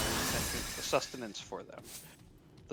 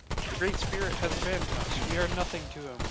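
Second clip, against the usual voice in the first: louder, higher anguished cry; anguished wail; 6 semitones higher, 6 dB louder than usual. A narrator's reading. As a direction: wail it in despair. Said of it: "Saril wailed in anguish".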